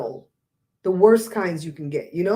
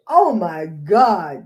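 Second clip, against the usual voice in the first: very slow, louder gasp of shock; drawn-out oh my God; 2 semitones lower, 6 dB louder, much slower than usual. Retold or imitated. Vlogger retelling the moment she found the obituary, slow and shocked. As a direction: stretch it out in disbelief. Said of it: "I said".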